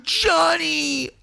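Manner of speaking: very high wailing lunatic imitation; mock hysterical pleading. Wife pleading with Johnny after he left, mock hysterical. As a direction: wail and plead in exaggerated falsetto. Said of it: "You sound like a lunatic".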